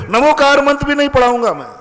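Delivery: high-pitched, forceful imitation of a refusal; emphatic mock refusal. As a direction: re-enact a defiant no, pitched well up. Re-enacts the man's blunt refusal.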